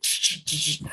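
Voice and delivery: unvoiced clicking taser noise; imitated taser clicks. Imitating the cop clicking a taser at him, with clicking noises. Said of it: "He's like".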